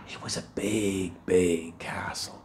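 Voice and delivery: soft, low, awed hush; hushed awe. A narrator's reading. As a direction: breathe it out in wonder. Jack whispers in awe.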